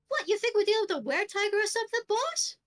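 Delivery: high, loud, rapid questioning; incredulous underling. Acted. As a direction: fire the doubt at the boss, high and fast. Underling doubts the boss's claim.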